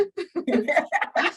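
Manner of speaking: a short, high, bright laugh; light laugh. Real, not acted. Guest laughing after calling herself a queen, light laugh. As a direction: chuckle at your own joke.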